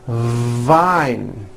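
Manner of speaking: VINE with a long buzzing V at the start; stretched V. Shown as a demonstration. Teacher modelling practice word, long V in VINE. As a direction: hold the V before opening into VINE. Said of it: "words with the V sound".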